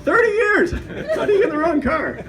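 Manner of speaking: high, loud comic disbelief; incredulous outcry. Acted. Roasting a friend who got in the wrong car, incredulous outcry. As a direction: exclaim it high in mock exasperation.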